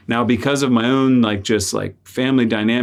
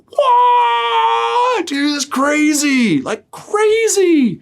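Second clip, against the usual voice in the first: stunned disbelief; much slower than usual. Retold or imitated. Son hears the truck sold, crying out in amazed disbelief. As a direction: shout out the wonder, stretched slow.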